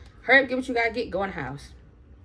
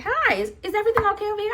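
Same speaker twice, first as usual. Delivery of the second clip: high, quick customer-service voice; pitched-up, hurried polite inquiry; store worker; 10 semitones higher, faster than usual. Retold or imitated. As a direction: imitate a worker checking in. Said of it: "she was just like".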